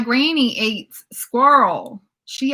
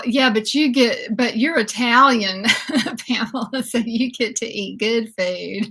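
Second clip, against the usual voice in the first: normal talking voice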